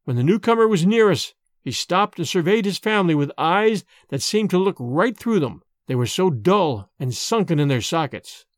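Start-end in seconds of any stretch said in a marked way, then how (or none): none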